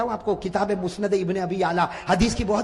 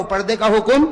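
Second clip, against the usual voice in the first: call and repeat; 7 dB louder, slower than usual. Shown as a demonstration. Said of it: "ساتھ میں کہو".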